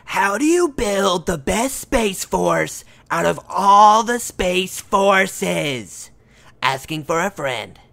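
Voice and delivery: slow, loud put-on announcer voice; drawn out in a booming put-on voice. Reading a listener question aloud in a silly put-on voice. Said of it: "Let's see if I can do it in the voice".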